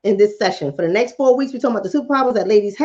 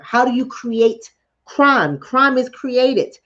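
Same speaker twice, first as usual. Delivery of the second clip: slow emphatic; slower than usual. Real, not acted. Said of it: "slow emphatic teaching".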